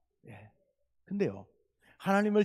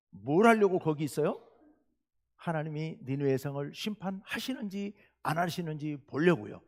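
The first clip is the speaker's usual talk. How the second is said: ordinary talk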